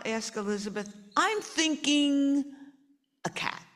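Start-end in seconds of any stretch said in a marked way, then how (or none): 1.2-3.6 high, slow, sly hint; sly child voice. Acted. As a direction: drop the hint slowly. Sly suggestion.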